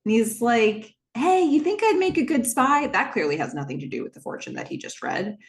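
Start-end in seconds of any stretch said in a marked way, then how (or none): 1.1-2.9 high, louder call to someone off in another room. Retold or imitated. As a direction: imitate someone shouting across the house. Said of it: "he's shouting to somebody else".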